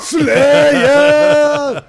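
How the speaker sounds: loud high metal shout. Real, not acted. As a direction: belt the band name, full volume. Echoing the band shout.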